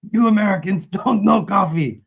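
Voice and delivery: put-on Iron Sheik accent. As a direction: ham it up like an eighties wrestling heel with an Iron Sheik accent. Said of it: "like the eighties W W F Iron Sheik accent".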